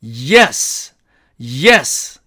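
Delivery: "yes" with a held Y glide, drawn out; emphatic initial Y. Shown as a demonstration. Teacher corrects a flat reading, emphatic Y glide. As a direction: lean into the Y of "yes", then let it fall. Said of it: "yes가 아니고".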